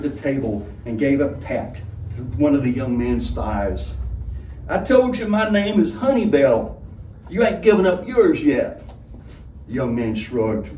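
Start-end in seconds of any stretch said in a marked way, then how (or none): 4.7-8.8 high, loud barmaid voice; coarse tavern woman voice. A narrator's reading. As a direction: play the rough old tavern keeper. Honeybell voiced by narrator.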